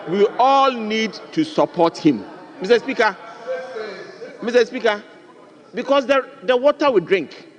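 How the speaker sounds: rally the house behind him; impassioned appeal. Real, not acted.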